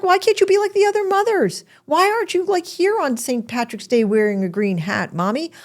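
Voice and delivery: mock children's complaint, pitched high; whiny kids. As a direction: nag like a kid pestering mom. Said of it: "They were like".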